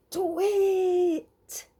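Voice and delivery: high, loud owl shout; shouted at full voice. A narrator's reading. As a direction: shout it at the top of your voice. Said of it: "they shouted at the tops of their voices".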